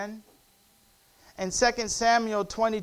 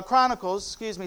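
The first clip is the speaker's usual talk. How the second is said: ordinary delivery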